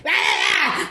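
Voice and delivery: a sharp high yelp at her; mock shriek, high and sudden; retold yell. Door lady yelling at the comedian, sharp high yelp. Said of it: "Like, yelled at me".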